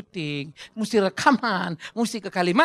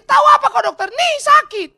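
very high, loud indignant shout; hammed-up patient hollering at the doctor; yelling in pain; 13 dB louder than usual. Patient arguing with the doctor, yelling in pain. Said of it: "dia teriak-teriak sakit".

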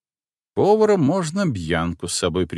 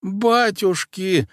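put-on high, slow, delighted old woman; a drawn-out gasp of joy; joyful exclamation; 11 semitones higher, much slower than usual. A narrator's reading. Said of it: "радостно воскликнула тётка".

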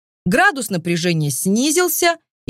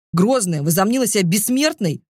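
usual speaking voice

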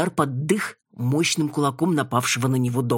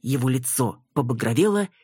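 ordinary delivery

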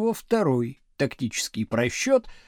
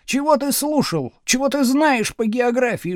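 higher-pitched teacher's challenge; pressing challenge; 6 semitones higher, 4 dB louder than usual. A narrator's reading. Teacher cornering a boastful pupil, pressing and cross. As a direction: press the pupil hard with each question. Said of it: "теснил Слушкин".

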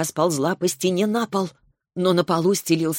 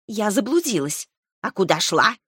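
ordinary delivery

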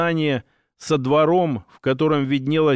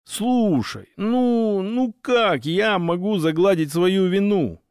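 whiny, drawn-out, higher; plaintive; 4 semitones higher, slower than usual. A narrator's reading. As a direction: plead it in a whiny voice. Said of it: "жалобным голосом протянул я".

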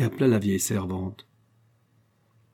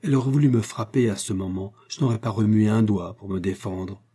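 ordinary delivery, nothing marked

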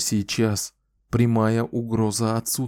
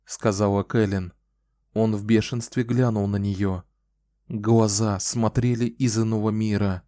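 ordinary delivery

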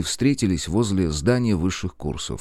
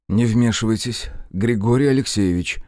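ordinary talk, nothing marked

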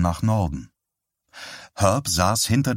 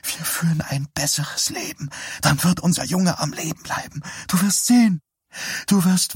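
pleading; 9 semitones higher than usual. Acted. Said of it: "sah ihn dabei flehentlich an".

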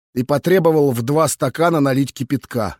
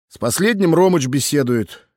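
ordinary delivery

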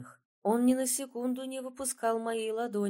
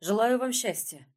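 nervous; 3 semitones higher, 6 dB louder than usual. A narrator's reading. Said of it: "испуганно блеснул глазами и выдохнул".

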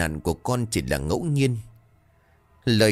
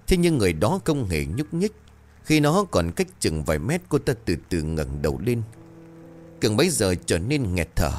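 plain reading voice

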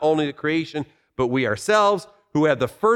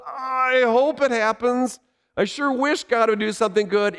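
raised, half-hearted wishing tone; wishful mock hope; 7 semitones higher than usual. Preacher mocking weak wishful hope, pitched up. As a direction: sound like you're only wishing it might happen. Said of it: "It is hope that it will happen".

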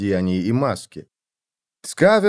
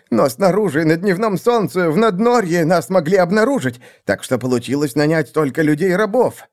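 high-pitched, self-justifying plea; pleading excuses; 6 semitones higher than usual. A narrator's reading. Assassin excuses failure to the seer, pleading. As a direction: argue your innocence in a raised voice.

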